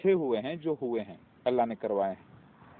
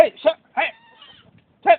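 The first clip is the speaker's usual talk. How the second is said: shooing a dog; 14 semitones higher, 9 dB louder than usual. Real, not acted. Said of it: "اس کو ہکالنا ضروری ہے".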